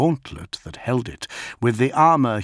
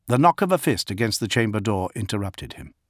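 plain reading voice

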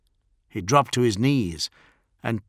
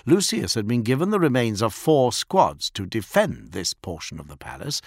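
plain reading voice

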